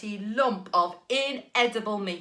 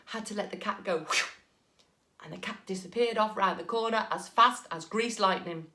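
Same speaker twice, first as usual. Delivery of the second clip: ordinary delivery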